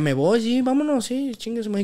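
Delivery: high, eager burst of yeses, climbing; excited yes. Retold or imitated. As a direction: blurt it out like a thrilled kid. Emoción al irse a Chivas. (emocionado, más agudo)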